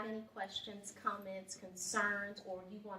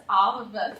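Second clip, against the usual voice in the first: ordinary delivery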